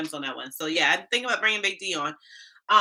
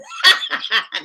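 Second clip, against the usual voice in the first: loud laugh; 7 semitones higher, 9 dB louder than usual. Real, not acted. Laughing at a viewer's Bahamian guess, loud laugh.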